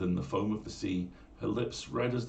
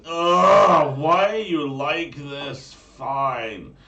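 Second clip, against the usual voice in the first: loud, high annoyed groaning whine; exasperated Valley Girl; 7 semitones higher, 13 dB louder, much slower than usual. Pretend. Tival answering his battle cry, loud exasperated Valley Girl. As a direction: sigh it like a put-upon Valley Girl. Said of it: "I will always hear her going".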